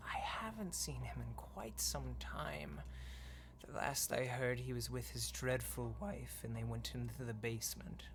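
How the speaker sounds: hushed unvoiced whisper, dropping away; whispering ghost maid. Ghost maid hushing the player by the crib. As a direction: shush them like a ghostly nanny.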